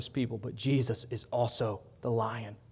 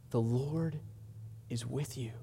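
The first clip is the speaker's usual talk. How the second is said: softer, settled answer; soft reassurance; 7 dB quieter than usual. Acted. Preacher reassuring the weary congregation, softly. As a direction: drop down and comfort them.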